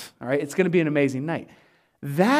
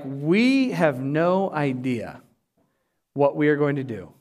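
slow, low, deliberate admission; drawn-out "no idea", dropping low; acted bewilderment; 5 semitones lower, slower than usual. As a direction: imitate being at a loss, word by word. Disciples at a loss, acted out.

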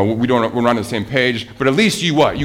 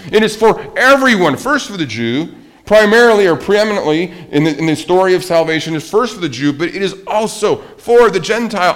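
rising, louder preaching, higher and louder on each also; 5 semitones higher, 6 dB louder than usual. Acted. Preacher to congregation, pressing that salvation is for everyone, at full force. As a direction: build it like a pulpit climax.

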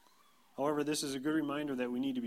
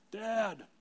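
put-on high-pitched child calling for Dad; drawn-out, high call; yelling four-year-old; 10 semitones higher, 3 dB louder than usual. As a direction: mimic a small kid yelling from another room. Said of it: "Tate, who is four, our youngest, yells".

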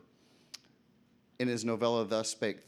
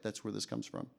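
ordinary talk, nothing marked

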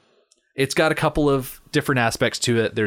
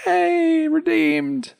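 high, drawn-out mock cheer; excited crowd cheer; much slower than usual. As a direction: squeal it like an overjoyed crowd. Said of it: "They're all excited".

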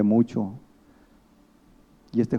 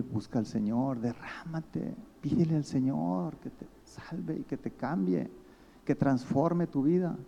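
softer, higher, tender urging, slowing at the end; gentle pleading; 5 semitones higher, 5 dB quieter than usual. Retold or imitated. Preacher urging the troubled boy to seek God, soft and pleading. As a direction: coax him softly toward God.

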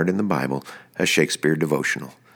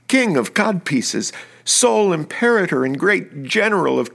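high, slow, theatrical Shakespeare declamation; hammy stage actor; 7 semitones higher, slower than usual. Acted. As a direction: ham up the lovesick soliloquy, slowly. Dramatic Shakespeare soliloquy reading.

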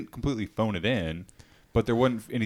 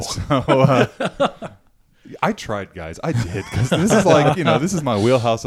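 laughing, louder; 3 semitones higher, 9 dB louder, slower than usual. Real, not acted. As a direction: laugh through the confession. Laughing admission of failure.

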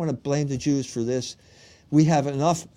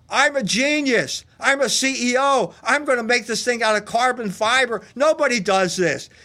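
higher, mock-arrogant CEO bragging; lightly voiced smug genius, pitched up; boastful CEO; 7 semitones higher than usual. Mocking Stockton Rush's boasts.

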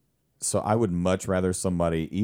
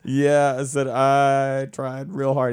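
laughing retold reply; 8 semitones higher, 5 dB louder, slower than usual. Retells embarrassed reply, laughing.

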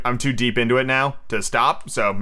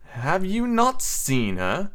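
high, slow troubled question; becoming troubled; 6 semitones higher, much slower than usual. A narrator's reading. Peter asking the boys about Wendy, troubled. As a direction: draw out the question in a put-on grand voice. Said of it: "asked Peter, becoming troubled".

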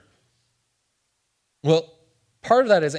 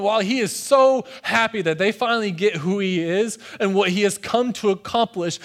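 usual speaking voice

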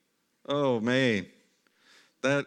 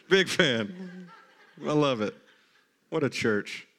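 real amused laughter through the words; laughing; slower than usual. Real, not acted. Pastor enjoying his mixed congregation, laughing.

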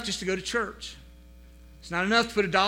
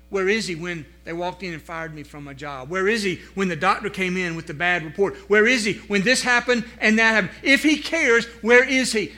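building questions; 6 dB louder than usual. Acted. Preacher voicing people's doubts to the congregation, building to loud. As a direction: build each question louder.

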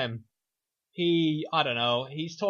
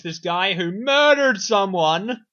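"murdered" drawn out, loud and raised; stress on murdered; 4 semitones higher, 8 dB louder, much slower than usual. Real, not acted. Pointing out the hero's hypocrisy, loud stressed "murdered". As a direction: lean hard on "murdered" in protest.

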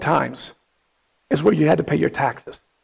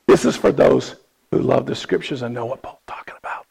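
soft, breathy aside whispered to the room; hushed whisper; 6 semitones lower, 5 dB quieter than usual. Real, not acted. Preacher whispers a pointed aside to his congregation. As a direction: lean in and whisper it like a secret.

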